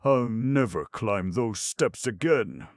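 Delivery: slow, high, stubborn ox; ox voice, declaring. A narrator's reading. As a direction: dig in and refuse. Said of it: "he declared".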